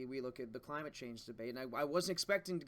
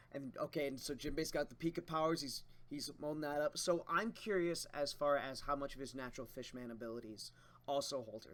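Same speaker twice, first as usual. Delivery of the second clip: ordinary talk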